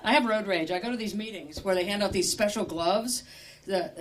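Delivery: ordinary talk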